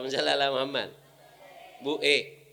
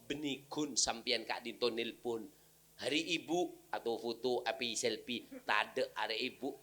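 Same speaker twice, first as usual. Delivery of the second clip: usual speaking voice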